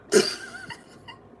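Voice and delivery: long high-pitched squeal of laughter. Real, not acted. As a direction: let out a loud squealing laugh. Laughing at her own story.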